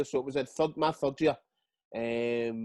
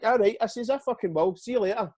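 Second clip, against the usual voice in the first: defiant storm-off; 8 semitones higher than usual. Retold or imitated. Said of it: "And I went".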